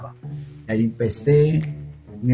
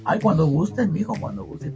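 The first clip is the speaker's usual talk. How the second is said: higher, lightly voiced teacher; imitated obliging teacher; 6 semitones higher than usual. Teacher replying to would-be student, lightly imitated welcoming voice.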